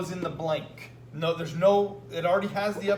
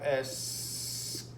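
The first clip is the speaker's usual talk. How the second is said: slow demo; 4 semitones lower, 8 dB quieter than usual. Shown as a demonstration. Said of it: "I change the O to A S".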